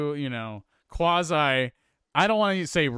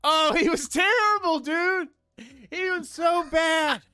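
very high, exasperated shout; high-pitched, incredulous outburst; emphatic disgust; 13 semitones higher than usual. Real, not acted. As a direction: cry out the damning verdict. Heated verdict on an actor.

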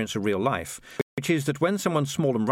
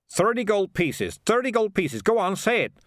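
high, urgent heckle from the back; high-pitched calling out; shouted prompt; 8 semitones higher than usual. A narrator's reading. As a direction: egg someone on from the crowd. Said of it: "shouted the voice at the back".

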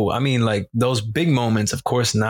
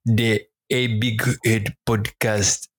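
very slow, put-on African accent; attempted African accent; 2 semitones lower, slower than usual. Host retrying the podcast sign-off, badly faked African accent. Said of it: "I was trying to do an accent".

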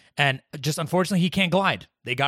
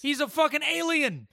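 high-pitched exasperated protest; high, insistent rebuttal; heated objection; 9 semitones higher than usual. Real, not acted. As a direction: argue back, voice shooting up. Heated argument over a character's race.

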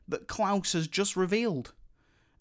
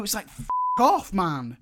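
exasperated outburst; 4 semitones higher than usual. Real, not acted. Speaker fuming at the song interrupting the score, exasperated. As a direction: burst out, fed up.

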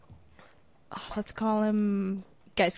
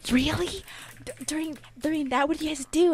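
high-pitched, louder gossipy questioning; imitated eager surprise; 7 semitones higher, 4 dB louder than usual. Girl pressing her friend for gossip, imitated eager surprise. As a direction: pitch it up, hungry for details.

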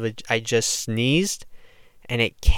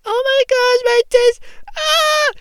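shrill mock freakout, much higher; mock scream; 7 dB louder, slower than usual. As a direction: squeal it out in fake panic. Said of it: "scream and be like".

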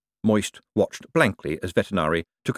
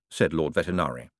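ordinary delivery

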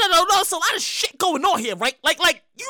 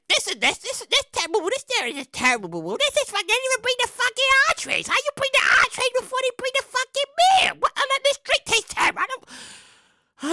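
a hint of a complaining mother, a bit higher and softer; imitating mom; 6 semitones higher, 3 dB quieter than usual. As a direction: mimic mom grumbling across the table. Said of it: "and she's like".

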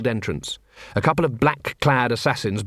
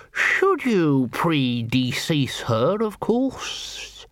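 droning lawyer; much slower than usual. A narrator's reading. Lawyer explaining inheritance to Vimes, droning. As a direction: drone on like a dry lawyer. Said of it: "Mister Morecambe droned on".

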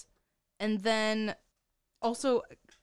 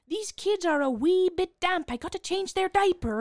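mock Scottish accent; 9 semitones higher, 4 dB louder than usual. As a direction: imitate a clumsy fake Scottish nanny accent. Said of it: "putting on this weird Irish slash Scottish accent".

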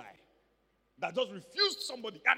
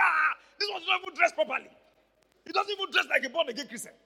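loud, higher protest to God; indignant exclaim; 6 semitones higher, 9 dB louder than usual. Retold or imitated. Preacher retelling his envy of the shorts guy, loud protest. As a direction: complain to the Lord, loud and higher. Indignant inner complaint to Lord.

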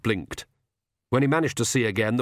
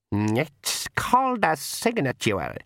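slow, higher pixie dialect; old pixie; 4 semitones higher, slower than usual. A narrator's reading. Old pixie hands the king a pen, slow dialect voice. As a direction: spell it out slowly as an old pixie.

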